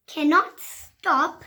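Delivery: ordinary delivery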